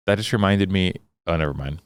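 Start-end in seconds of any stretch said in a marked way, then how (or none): none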